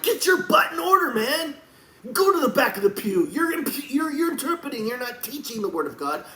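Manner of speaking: high, loud drill-sergeant chewing-out; forceful scolding shout, easing off at the end; yelling at Christians. Acted. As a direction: bark orders at slacking believers. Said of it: "when I'm yelling at you Christians and I'm like".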